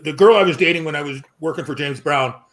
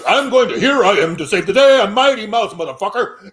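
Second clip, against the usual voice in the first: raised, louder mock-heroic proclamation; lightly voiced macho hero; 5 semitones higher, 5 dB louder than usual. Mocking a novelist's male characters, light hero voice. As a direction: lightly send up a self-important hero. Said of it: "male characters are all like".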